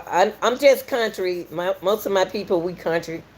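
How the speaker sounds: normal talking voice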